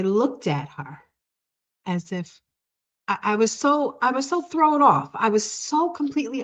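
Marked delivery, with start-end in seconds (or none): none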